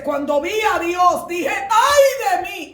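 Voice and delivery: shouted high and very loud, drawn out on "Ay de mí"; grito de profeta. Acted. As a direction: cry out like the prophet overwhelmed at seeing God. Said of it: "cuando gritó así". (grito agudo y prolongado de profeta)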